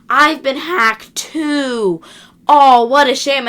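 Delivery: drawn-out slow fake pity; milk the fake sorrow, mock the listener; slow hammed-up what-a-shame; mock fake innocence. Hacker fakes innocence, mocking.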